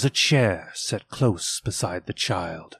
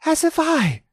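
put-on much higher boy's cry; Peter's cry. A narrator's reading. Peter agrees with mother, high eager boy's cry. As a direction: chime in eagerly as a boy. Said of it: "cried Peter".

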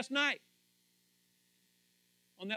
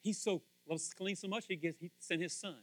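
ordinary talk, nothing marked

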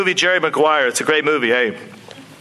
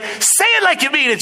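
high, urgent acted coaching; firing him up; 6 semitones higher than usual. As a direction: urge your agent to commit, pitched up. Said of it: "starts getting Jerry fired up".

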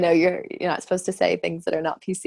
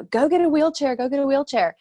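retold shout; 8 semitones higher than usual. Doctor calls to the frozen valet, urgent high order. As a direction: call the order across, urgent. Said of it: "has shouted to this valet guy".

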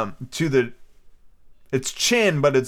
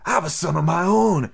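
put-on higher, slow old sea dog; sly pirate; 5 semitones higher than usual. Stranger toasting his mate Bill, slow pirate voice. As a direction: drawl it like a scheming sailor.

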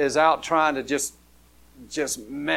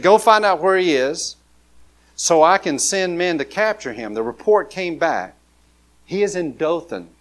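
louder, stirring Bible reading; scripture reading; 3 semitones higher, 6 dB louder than usual. Acted. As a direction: read it out like a preacher to the room.

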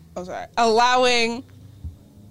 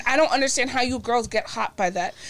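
usual speaking voice

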